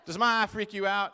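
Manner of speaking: loud imitation of an Alabama woman's accent; Southern US accent. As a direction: mimic a blunt Southern wife, loudly. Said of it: "this is what she said to me".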